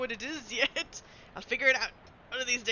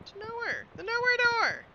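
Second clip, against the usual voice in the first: higher, building to a louder call on "nowhere door"; rising exclamation; 12 semitones higher, 6 dB louder than usual. Acted. The player discovers the door and calls out its name louder.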